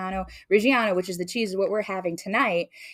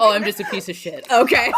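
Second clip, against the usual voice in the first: self-mocking through laughter; laughing; 6 semitones higher, 10 dB louder than usual. Real, not acted. Laughing at her own bad accent. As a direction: laugh at yourself, loud and high.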